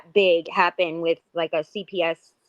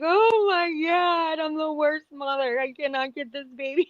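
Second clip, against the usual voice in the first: mock crying; 9 semitones higher than usual. Mother recalling her colicky-baby days to another mother, mock crying. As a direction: imitate your own overwhelmed crying, higher and stretched out. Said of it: "I would cry so hard".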